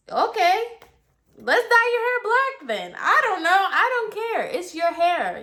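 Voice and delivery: high-pitched, throw-up-your-hands delivery; sing-song not-my-problem attitude, very high; exasperated giving-in. Retold or imitated. Acts out giving in to client.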